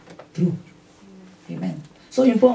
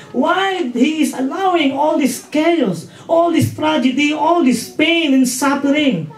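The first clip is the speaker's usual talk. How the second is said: slow, higher, pleading questions about suffering; questioning challenge; 6 semitones higher, slower than usual. Retold or imitated. Coworker Debbie asking why God allows suffering, slow and questioning. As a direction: voice a coworker's doubting challenge, drawn out. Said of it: "sabi niya".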